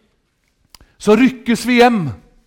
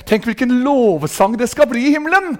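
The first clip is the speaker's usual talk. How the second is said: normal talking voice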